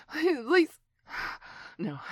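normal talking voice

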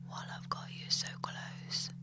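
soft, close whispered taunts; menacing whisper. Acted. Captor whispering cruel taunts into the captive's ear.

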